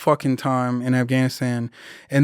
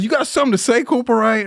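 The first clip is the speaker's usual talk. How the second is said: mock first sergeant challenge; 8 semitones higher, faster than usual.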